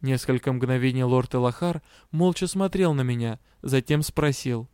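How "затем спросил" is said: plain reading voice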